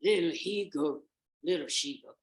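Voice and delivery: loud rough first try at the song; clumsy rehearsed chant; wolf practicing. Acted. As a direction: try out the mother's tune as the wolf. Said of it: "he began to practice".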